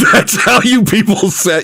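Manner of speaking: laughing through the words. Real, not acted. Teasing friend laughing at the fake accent. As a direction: breaks into laughter mid-sentence.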